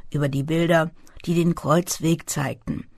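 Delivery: ordinary delivery, nothing marked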